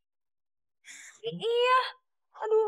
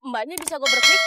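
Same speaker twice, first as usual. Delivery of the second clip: calling out; 3 semitones lower, 9 dB louder, faster than usual. Acted. Calling out to the driver.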